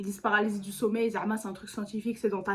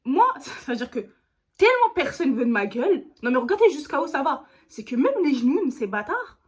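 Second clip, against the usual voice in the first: laughing indignation; 4 semitones higher, 6 dB louder than usual. Real, not acted. Outraged that djinns reject her.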